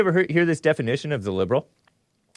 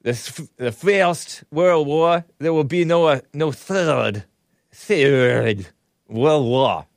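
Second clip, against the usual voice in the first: admiring but mocking Ukrainian accent; imitated Ukrainian accent; slower than usual. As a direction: mimic a Ukrainian president, drawing out the words. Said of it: "I love that accent".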